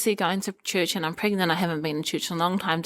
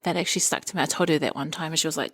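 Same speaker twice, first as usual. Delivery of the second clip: usual speaking voice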